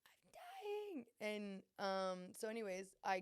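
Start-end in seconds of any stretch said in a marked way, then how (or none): 0.1-1.1 much higher, unvoiced, hammed-up whisper; mock dying. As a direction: fake your last breath, over the top. On the floor in a fit.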